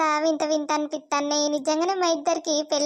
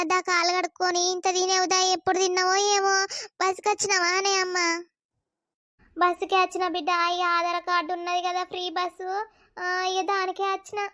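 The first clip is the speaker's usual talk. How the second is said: ordinary delivery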